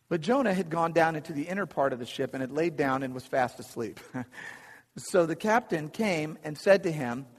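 normal talking voice

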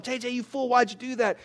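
mildly exasperated talk to oneself; self-scolding. Acted. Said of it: "sometimes I say it about myself".

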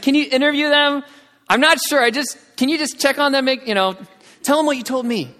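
high, loud, urgent request; anxious pleading. Retold or imitated. Sister asking missionary to check her brothers, anxious pleading.

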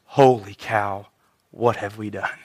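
very low, slow, hushed realization; stunned hush. Acted. The accusers realize what they did, stunned. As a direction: let it sink in, barely voiced. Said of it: "There was no laughing when he said that".

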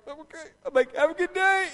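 chirpy cover-up despite the sting; forced cheerful. Retold or imitated. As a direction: pretend to the tenant you're fine. Faking fine to tenant after sting.

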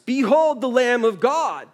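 proclaiming. Retold or imitated. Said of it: "It's screaming at us".

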